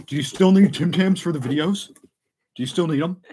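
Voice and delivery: put-on eager question, half laughing at the end; imitating the senders. Retelling what senders ask about Tim Tams, imitating them. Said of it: "They're like".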